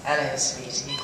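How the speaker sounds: low, flirty character greeting; requested catchphrase. Acted. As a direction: deliver the signature greeting on request. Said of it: "For the record, you say".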